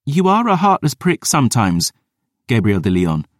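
lightly voiced Dior; exasperated girl. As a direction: chide him sharply. Dior's voice.

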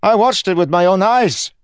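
high, loud insistence; alarmed guard. Acted. Guard swears to what he witnessed, alarmed shout. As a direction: insist at the top of your voice.

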